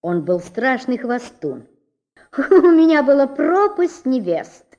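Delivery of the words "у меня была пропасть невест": boastful chatter. A narrator's reading. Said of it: "тараторил он".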